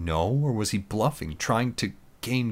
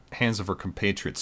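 plain reading voice

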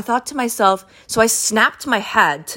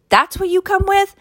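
incredulous repeat. Real, not acted. Mocking the woman's choice, high and incredulous. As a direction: throw the question back in shock.

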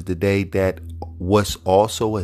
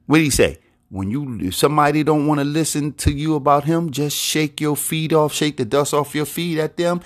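raised, urgent preaching voice; fervent preaching; 7 semitones higher than usual. Acted. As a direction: press the warning on the congregation. Preaching at higher pitch.

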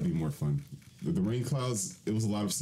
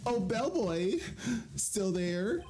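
high character voice; 13 semitones higher, much slower than usual. Acted. Voicing game character.

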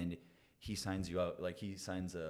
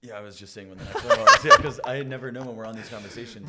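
laughing; 5 semitones higher, 17 dB louder than usual. Real, not acted. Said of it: "you're on the phone".

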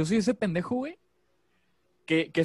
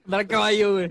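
laughing; 5 semitones higher, 4 dB louder than usual. Real, not acted. Joking list, laughing.

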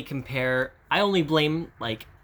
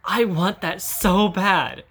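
drawn-out longing; 6 semitones higher, 5 dB louder, much slower than usual. Real, not acted.

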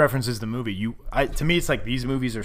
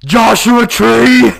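slow, loud drawn-out bellow; crowd-pop yell; slow hype shout; 10 semitones higher, 15 dB louder, much slower than usual. Pretend. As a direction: drag out each word, yelling. Hype bit climax.

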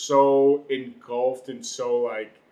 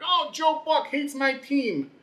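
high-pitched, drawn-out mock fan whine; complaining fan; 13 semitones higher, slower than usual. Sports podcaster mimicking fans griping about Joe Buck, mock whine.

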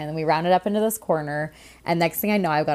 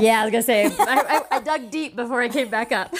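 laughing; 5 semitones higher, 3 dB louder than usual. Real, not acted. Laughing at herself.

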